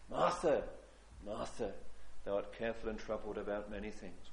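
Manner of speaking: a hushed, tender chiding of Martha; mild rebuke. Acted. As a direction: correct her kindly and quietly. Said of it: "to mildly rebuke Martha".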